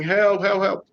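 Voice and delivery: mild retold scream for help; mock scream for help. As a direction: cry out for rescue across the water. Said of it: "But I started screaming".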